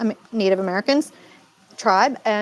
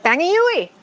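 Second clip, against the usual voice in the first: higher, playful Massachusetts catchphrase; pitched-up Boston-area slang; Mass accent; 7 semitones higher than usual. Retold or imitated. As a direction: toss it out like a New England local. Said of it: "I'm like".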